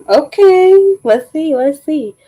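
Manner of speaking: high eager anticipation; bright eager let's see; excited. Real, not acted. Eager first taste.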